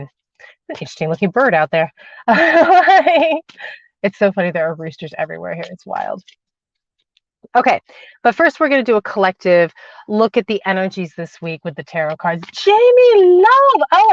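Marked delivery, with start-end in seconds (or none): 2.3-3.7 bright burst of laughter at the bird; sudden happy laugh; real laugh. Real, not acted. Laughs at the rooster outside. 12.5-14.1 much higher, louder, drawn-out call of the name; slow, thrilled welcome; excited greeting. Real, not acted. Greets a viewer in chat.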